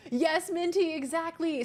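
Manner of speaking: light up answering a friend; excited agreement. Real, not acted. Streamer eagerly answering chat, excited.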